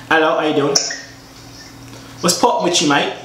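a lightly faked Birmingham British accent; put-on British accent. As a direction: greet them in a mock British accent. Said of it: "That's not Birmingham accent".

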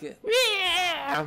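high mocking shriek of the monsters; imitating the scream. Gamer mimicking enemy screams with a high shriek. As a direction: shriek it like the creatures do. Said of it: "The fact that they scream, like".

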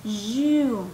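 "you" drawn out with a consonant y at the start; consonant sound. Shown as a demonstration. Teacher shows students the sound, "you" with a consonant onset. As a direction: say "you" slowly, starting on a y glide. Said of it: "se escucha un sonido de consonante".

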